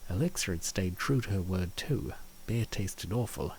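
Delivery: ordinary talk, nothing marked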